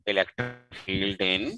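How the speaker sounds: word-by-word dictation for note-takers; slow dictation. Real, not acted. Teacher reading out a physics question to the class, slow dictation. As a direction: dictate very slowly, stretching the words, with long pauses.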